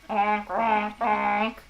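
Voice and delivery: ordinary delivery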